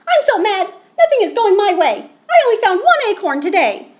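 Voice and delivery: very high, loud, fast angry squeak; mad squirrel. Acted. Squirrel complains to Pete, a high, loud, fast angry squeak. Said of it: "Squirrel did not look happy".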